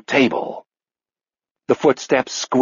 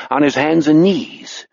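plain reading voice

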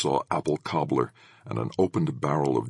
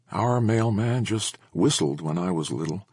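disappointed; 7 semitones higher than usual. A narrator's reading. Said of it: "She sounded a little disappointed".

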